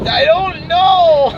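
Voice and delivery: loud, high, drawn-out whiny imitation; mock frustration. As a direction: mimic a friend wailing in frustration. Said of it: "You get really frustrated".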